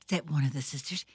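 plain reading voice

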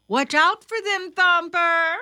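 high, firm motherly call; mother warning. A narrator's reading. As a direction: call out a brisk warning to a child.

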